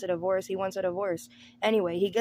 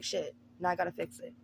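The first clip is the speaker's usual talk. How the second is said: quiet deadpan; 2 semitones lower, 8 dB quieter than usual. Acted. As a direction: mutter it under your breath. Flat resigned muttered aside.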